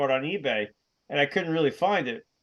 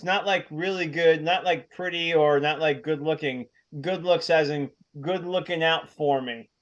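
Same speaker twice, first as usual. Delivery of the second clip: normal talking voice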